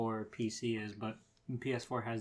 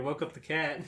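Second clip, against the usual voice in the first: ordinary talk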